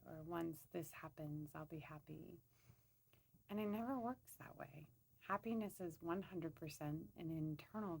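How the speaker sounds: soft, even-pitched meditation voice; guided meditation. Acted. Woman leading listeners to center and scan their bodies, soft meditation voice. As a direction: gently guide them inward, leaving long pauses.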